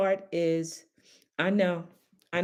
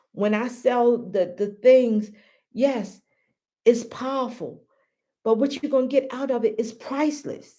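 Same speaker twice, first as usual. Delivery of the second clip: ordinary talk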